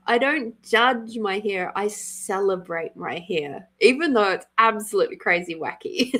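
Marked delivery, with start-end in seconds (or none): none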